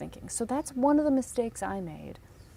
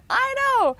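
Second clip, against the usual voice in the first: high, loud laughing exclamation; laughing agreement; 7 dB louder than usual. Real, not acted. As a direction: burst out agreeing through a laugh. Laughing agreement with audience reaction.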